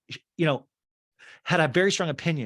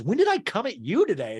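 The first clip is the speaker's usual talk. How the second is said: mock indignant Jen; 9 semitones higher, 4 dB louder than usual. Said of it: "And Jen goes".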